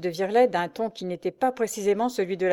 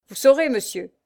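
hoarse curt voice; 5 semitones higher, 6 dB louder than usual. A narrator's reading. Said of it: "d'une voix rauque et brève".

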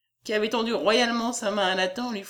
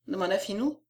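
plain reading voice

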